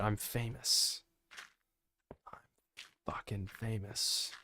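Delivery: slow, smug whisper right at the mic. Acted. Taunting a chatter who threatens to report him, in a slow smug whisper. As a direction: gloat it under your breath, drawn out.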